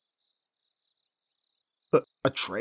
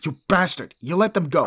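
loud, higher, outraged yell; furious shout; 4 semitones higher, 9 dB louder than usual. Acted. Bodyguard to the businessman who traded the client: furious shout. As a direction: hurl it at him in rage.

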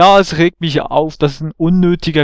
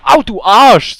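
loud pained curse; angry outburst; 6 semitones higher, 7 dB louder, slower than usual. Real, not acted. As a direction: yell it at the enemy. Hit in the game fight.

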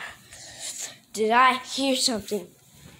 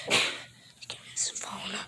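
whisper; 6 semitones lower, 11 dB quieter than usual. Acted. Whispered demand.

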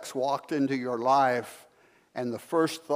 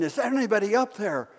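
high pleading call again; cry for help; 6 semitones higher, faster than usual. Acted. Jogger hanging from branch, calling higher and pleading. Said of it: "he cried again".

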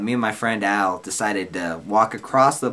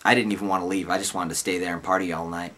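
ordinary talk

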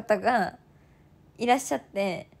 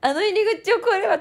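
psyched up; 8 semitones higher, 4 dB louder, faster than usual. Retold or imitated. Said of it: "助走をつけて".

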